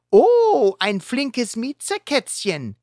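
high, sneering mock. Heuler taunting Catboy as he spins around them, mocking. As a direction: tease him like a little kitten. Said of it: "spottet Heuler".

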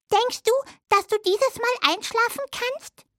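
high little mouse voice; little hazel dormouse. Acted. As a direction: play a tiny friendly mouse, pitched high. (hohe Stimme einer kleinen Haselmaus)

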